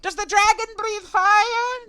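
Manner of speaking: drawn-out, very high screamed question; creature's full shout. Acted. Small Alliamite shouting about the dragon, slow piercing yell. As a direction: shriek it slowly at the top of a little voice. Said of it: "shouting out".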